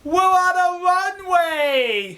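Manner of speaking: high, long-drawn mock shout; alarmed cry. Cab driver alerts his passenger to the danger, alarmed cry. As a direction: wail it out, stretching every word. Climax, retells alarm on airport runway.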